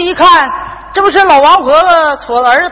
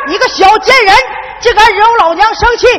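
mock angry scolding; 8 semitones higher than usual. Son fakes scolding wife again.

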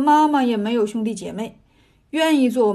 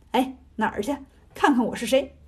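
ordinary delivery

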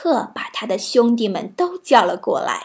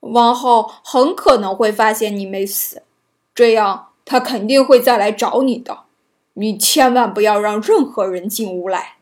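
earnest dwarf warning; 4 dB louder than usual. A narrator's reading. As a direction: caution her firmly as a dwarf. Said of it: "总会对白雪公主说".

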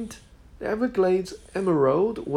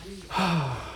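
exasperated sigh; 4 dB quieter than usual. Acted. Exasperated thief.

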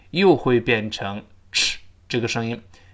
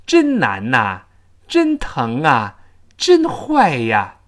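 slow, louder 真疼啊 真坏呀; linked particles; 5 dB louder, much slower than usual. Shown as a demonstration. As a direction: draw the linked endings out. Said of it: "自然的中文中其实也是个连读". (慢速连读语气词)

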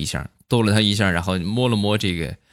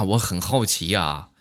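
ordinary delivery

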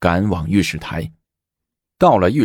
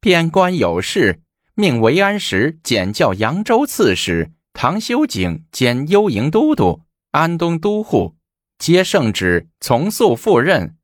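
much higher, sing-song eunuch reading; effeminate eunuch; 8 semitones higher than usual. Acted. Eunuch reads the decree to kneeling ministers, high and effeminate. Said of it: "内侍娘娘腔念道".

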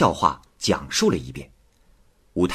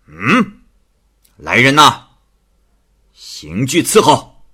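magistrate scolding loudly; stern rebuke; 5 dB louder than usual. Acted. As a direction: thunder at the girl and call for torture. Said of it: "就呵斥胭脂说". (太守厉声呵斥胭脂)

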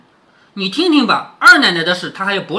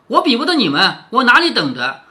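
ordinary delivery

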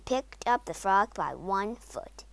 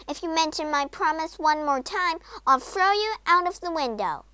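higher-pitched, furious warning; angry threat; 6 semitones higher than usual. A narrator's reading. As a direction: threaten the frog in raised pitch. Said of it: "snapped Poppy".

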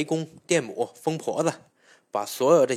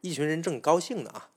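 ordinary delivery, nothing marked

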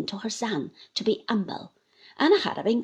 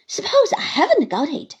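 high, loud retort; defiant Uriah; 7 semitones higher, 6 dB louder than usual. Acted. Uriah interrupts Traddles, high and defiant. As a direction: cut in defiantly.

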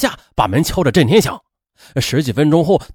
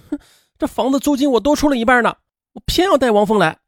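higher, defiant huff; angry defiance; 9 semitones higher than usual. A narrator's reading. Woman defies her friend about bringing her lover, higher angry huff. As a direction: spit back a stubborn refusal. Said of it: "她也愤怒地说".

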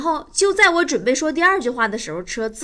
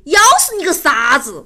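mock angry retort; 3 semitones higher, 6 dB louder than usual. Driver snaps back at radio.

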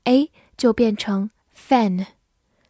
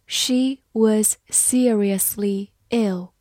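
slow, careful word-by-word reading; deliberate model reading; much slower than usual. Shown as a demonstration. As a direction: read it slowly for learners. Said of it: "好，慢慢来读".

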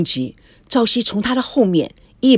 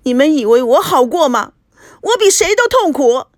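high, loud aggrieved wail; wife protesting her own pain, aggrieved; 7 semitones higher, 5 dB louder than usual. Acted. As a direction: cry out how hurt she is. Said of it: "无限的委屈说".

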